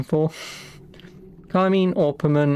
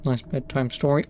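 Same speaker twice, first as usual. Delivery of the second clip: ordinary talk, nothing marked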